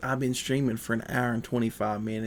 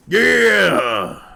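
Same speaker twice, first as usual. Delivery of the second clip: hillbilly whoop; 9 semitones higher, 11 dB louder than usual. Pretend. Whoop after the hick bit.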